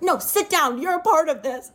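high, insistent imitation of an upset roommate; acted angry order. Acts roommate ordering her to stay.

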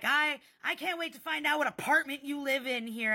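mock blustering parting shot; retold taunting threat. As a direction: throw a threat over the shoulder. Acted parting threat by angry man.

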